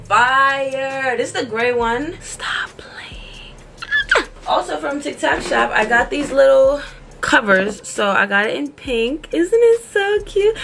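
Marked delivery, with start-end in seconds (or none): 2.2-3.5 whispered. Real, not acted. Reacting to her new sweater. 9.3-10.5 high, drawn-out gushing; excited squeal. Real, not acted. Gushing at her viewers over the pink covers.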